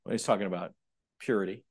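usual speaking voice

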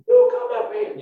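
tiny squeak, very high; squeaky little voice. Shown as a demonstration. Small David answers the giant, squeaking like Mickey Mouse. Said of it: "I did it with a squeaky little voice".

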